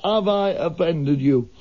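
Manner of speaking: put-on lurch through it like a drunk. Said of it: "He had the drunk".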